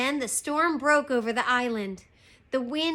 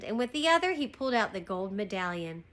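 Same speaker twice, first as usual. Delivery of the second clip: ordinary delivery, nothing marked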